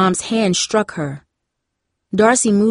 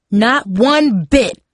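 slow, higher, emphatic mother's yell; narrated yell; 4 semitones higher, 4 dB louder, much slower than usual. A narrator's reading. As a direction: drive each word home, slowly and angrily. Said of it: "Mom yelled so loud".

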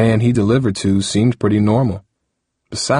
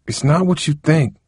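high, earnest plea; higher-pitched pleading protest; 8 semitones higher than usual. A narrator's reading. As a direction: plead with her to believe it. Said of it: "Harold pleaded".

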